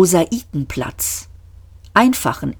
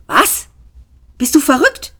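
high-pitched disbelieving question; acted astonishment, voice jumping up; incredulous; 9 semitones higher, 3 dB louder than usual. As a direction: react as if your friend has lost it. Friend reacts to absurd confession.

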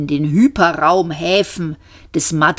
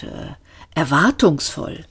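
ordinary delivery

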